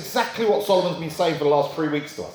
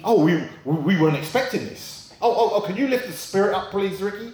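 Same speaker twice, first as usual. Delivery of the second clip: flustered presenter; 6 semitones higher than usual. Retold or imitated. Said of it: "And then they said".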